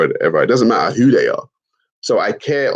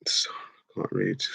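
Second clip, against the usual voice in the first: whispered aside, no voice at all; 11 dB quieter than usual. Real, not acted. To the chat, a whispered joke about not reading. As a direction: breathe it out like a confession. Joking self-deprecation to chat.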